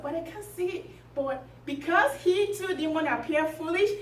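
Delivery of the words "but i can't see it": softer, higher puzzled aside; said to himself. Acted. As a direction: puzzle privately, keeping it down. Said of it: "He said to himself".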